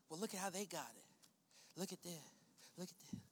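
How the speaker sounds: low, very soft grumbling aside; acted under-the-breath remark; muttered envy. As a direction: murmur it like gossip behind someone's back. Voices envious murmuring behind backs.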